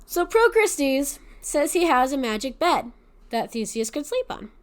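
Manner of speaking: ordinary delivery, nothing marked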